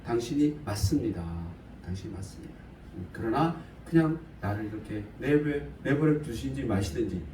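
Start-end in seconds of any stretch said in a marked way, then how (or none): 1.8-2.5 much softer, murmured like a prayer; quiet prayerful repeat. Shown as a demonstration. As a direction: say 당신이 맞습니다 again, far softer, as a humble prayer. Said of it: "우리도 그렇게 기도해야 되지 않을까?".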